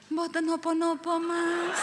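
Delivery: high, slow, sing-song wifely voice; drawn-out syllables, sweetly deferential; dainty Javanese wife. Retold or imitated. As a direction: put on an exaggeratedly polite Javanese wife. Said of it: "saya ngomong begitu sampai malam".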